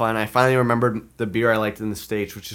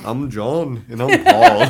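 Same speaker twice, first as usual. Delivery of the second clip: jokey two-Beatle impression, leaping up in pitch; [name] and [name]; 7 dB louder, slower than usual. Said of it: "my [name] impression".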